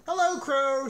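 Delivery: high, loud greeting; shouting. A narrator's reading. Toad shouting to the crow, high and loud. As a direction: call across to a bird. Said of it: "shouted Toad".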